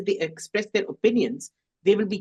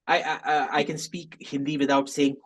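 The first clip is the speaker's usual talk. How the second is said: usual speaking voice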